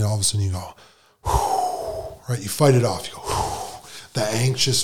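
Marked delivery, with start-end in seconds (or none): 1.2-2.2 exhaled whew. Retold or imitated. Fighting off anxiety. 3.2-3.9 exhaled whew. Retold or imitated. Fighting off anxiety.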